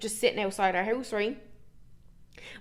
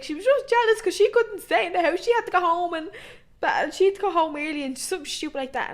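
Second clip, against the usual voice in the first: put-on pleading teen; 7 semitones higher than usual. Teen swearing to her uncle she wasn't drinking, whiny high plea. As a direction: beg like a caught kid.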